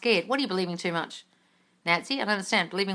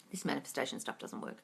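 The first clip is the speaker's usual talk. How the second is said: low, very soft self-critical muttering; muttered inner voice; 8 semitones lower, 16 dB quieter than usual. Acted. Coach acts out thoughts during silent sitting, low inner voice. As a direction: drop low and quiet, like a thought creeping in.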